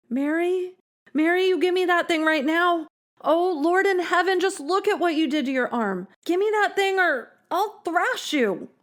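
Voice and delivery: mother pleading, then threatening; alarmed scolding mother. A narrator's reading. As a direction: scold her, frightened and stern. Mother confronting daughter.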